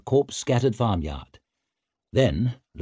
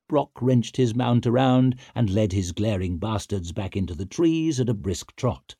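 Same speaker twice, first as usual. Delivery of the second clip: ordinary delivery, nothing marked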